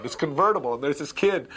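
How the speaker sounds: ordinary talk, nothing marked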